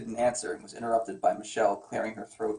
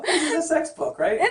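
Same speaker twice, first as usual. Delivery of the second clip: laughing; 4 dB louder than usual. Real, not acted. Joking to wife.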